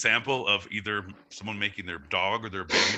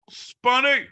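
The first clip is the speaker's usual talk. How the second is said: a high, loud callout of "Spunny"; 11 dB louder than usual. Real, not acted. Host calls out a nickname.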